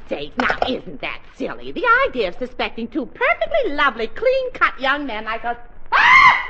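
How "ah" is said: very high shrieking cry of alarm; startled shriek as the truth hits. Acted. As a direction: let out a sudden piercing shriek. Realizes the candy was stolen.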